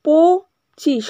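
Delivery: একশো পঁচিশ split into beats, very slow; teacher splitting a number into four beats; slow syllable break. Shown as a demonstration. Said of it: "কতগুলো ভাঙলাম দেখো".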